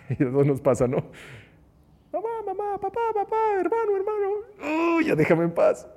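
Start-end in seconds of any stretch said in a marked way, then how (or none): 2.1-5.0 put-on very high, childish calling of each name; high-pitched little child voice repeating names; whiny child calling. Child pestering parents and brother. 5.0-5.9 a touch louder, exasperated brush-off; lightly acted annoyed parent shooing the kid away; mildly irritated, clipped dismissal; fed-up snap. Fed-up reply to nagging child.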